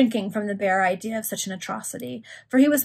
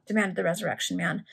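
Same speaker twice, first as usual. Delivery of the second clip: ordinary delivery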